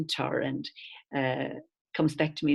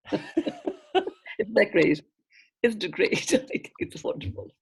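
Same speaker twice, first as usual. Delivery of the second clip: laughing; 6 semitones higher, slower than usual. Real, not acted. Laughing delight after quote.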